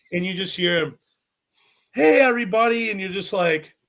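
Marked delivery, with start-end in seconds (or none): 1.9-2.9 loud, high mock hello to all; big projected greeting; imitated host greeting. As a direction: call out to the room like a host.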